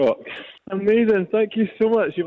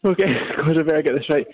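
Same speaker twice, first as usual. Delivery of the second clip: ordinary talk, nothing marked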